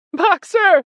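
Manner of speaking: very high, loud scream of dread; terrible cry. A narrator's reading. Clover seeing Boxer's face, crying out terribly. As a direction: scream the name in horror. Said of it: "cried Clover in a terrible voice".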